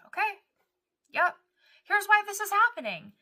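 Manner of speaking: high-pitched, chirpy imagined answer; mock-helpful voice, far above normal pitch; breezy mock reply. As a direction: pipe up high and loud, as a too-ready explainer. Imagined easy reply from God.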